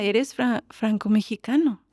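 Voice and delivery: normal talking voice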